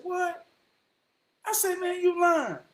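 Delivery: high-pitched mock disbelief; acted-out astonishment, voice pitched high; incredulous retelling. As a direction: jump way up in pitch, not buying it. Retelling own disbelieving reaction.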